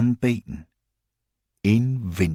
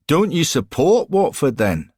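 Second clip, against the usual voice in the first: put-on light Scottish accent, raised and louder; 7 semitones higher, 4 dB louder than usual. Johnny questions Lamper about Watford, light Scottish accent. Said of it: "in the Scottish accent he'd got".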